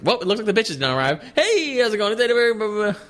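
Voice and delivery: high-pitched hammed-up show host greeting; high, sing-out hello tailing into blah-blah; [name]. Acts [name] starting the show.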